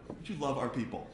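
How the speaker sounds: ordinary talk, nothing marked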